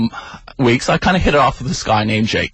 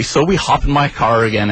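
ordinary delivery

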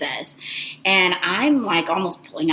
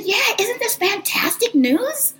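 imitated gushing, slow and high-pitched; gushing good news; slower than usual. Representative announcing fantastic news, mock gushing. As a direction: share news like a best friend. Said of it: "as if we were, like, best friends".

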